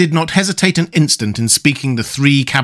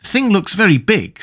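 mildly worried, higher pitch; anxious; 7 semitones higher than usual. A narrator's reading. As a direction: voice a doubt with a touch of anxiety. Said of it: "said he anxiously".

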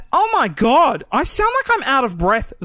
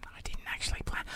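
barely-there whispered aside; whispered confession; 17 dB quieter than usual. Real, not acted. Host admitting to listeners he didn't plan, whispering. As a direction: drop to a tiny whisper, as if owning up.

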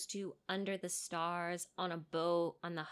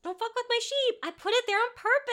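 high, loud, fast mock outrage; indignant hag; 13 semitones higher, 7 dB louder, faster than usual. Cohost joining in as the hag, high mock outrage. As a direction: squeal it like an offended goddess.

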